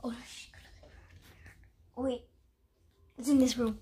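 ordinary delivery

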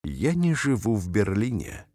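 ordinary delivery

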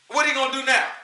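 usual speaking voice

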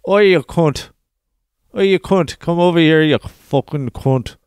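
loud, high-pitched thick Irish shout; thick Irish accent. Acted. African builder hailing someone on a building site, thick Irish accent. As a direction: call out roughly in a broad Irish brogue. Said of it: "with a fucking thick Irish accent".

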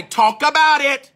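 high, loud, drawn-out urging; slow pew shout; slow church call-back. Retold or imitated. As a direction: stretch it out to egg him on. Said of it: "They might say".

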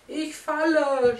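high, loud, slow cry of fright; falling scream. Acted. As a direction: wail it as you tumble out the window. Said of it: "schrie er".

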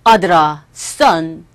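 low, weighty father's voice; deep, measured paternal address; father addressing son. Acted. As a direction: call to your son as a solemn father. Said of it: "아버지께서 말씀하시기를".

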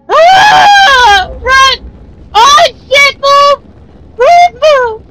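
high, loud terrified yells to run; panicked screaming. Real, not acted. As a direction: scream as it's right behind you. Fleeing monster, shouting at character.

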